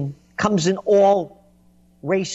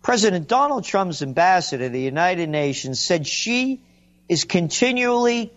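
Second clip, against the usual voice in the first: usual speaking voice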